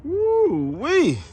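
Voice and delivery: high, drawn-out woo-wee; thrilled whoop at the wheels; excited woo-wee. Real, not acted. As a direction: let it fly up in delight. Admiring new wheels.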